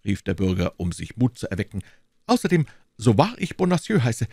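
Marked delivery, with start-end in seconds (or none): 2.2-4.3 high, fussy voice of the timid landlord; anxious little burgher. Acted. Bonacieux explaining to D'Artagnan, high and anxious. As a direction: play the nervous shopkeeper, pitched well up. Bonacieux swears by his name.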